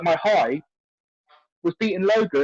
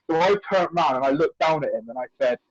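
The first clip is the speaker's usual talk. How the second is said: ordinary talk, nothing marked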